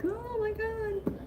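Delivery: high, slow hushed awe; awed gasp. Retold or imitated. Seeing the dancing Stitch online, high slow awe. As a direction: breathe it out amazed, slow. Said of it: "I was like".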